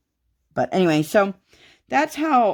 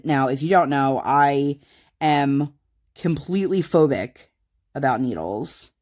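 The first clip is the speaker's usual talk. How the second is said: normal talking voice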